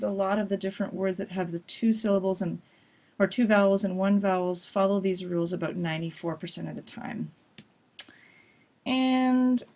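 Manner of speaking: ordinary talk, nothing marked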